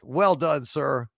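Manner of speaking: ordinary talk, nothing marked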